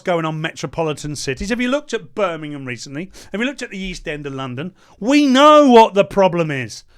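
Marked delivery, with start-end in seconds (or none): 5.0-6.8 much louder, forceful statement; angry declaration. Real, not acted. Telling the Greens outright, loud and angry. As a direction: say it like a shout. Angry outburst about crime.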